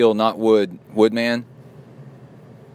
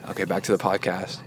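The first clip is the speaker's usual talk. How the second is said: normal talking voice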